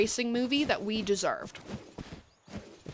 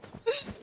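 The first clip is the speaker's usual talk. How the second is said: high breathy giggle; 4 dB quieter than usual. Real, not acted. Laughing after whisper.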